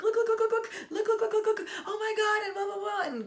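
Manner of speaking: very high, loud, laughing "look, look"; excited squeal. Retold or imitated. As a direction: squeal it like you just got engaged. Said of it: "crying and losing it".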